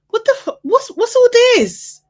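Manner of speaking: very high-pitched disbelieving outburst; exasperated squeal. Real, not acted. Viewer baffled by his pickiness, exasperated squeal.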